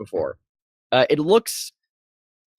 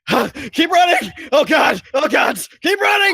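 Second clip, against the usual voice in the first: high, loud frantic yelling; panicked shouting; 13 semitones higher, 4 dB louder than usual. Acted. Ranger shouting to his companion to keep running, frantic yell. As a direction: yell it while fleeing in terror. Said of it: "he just shouts after the other".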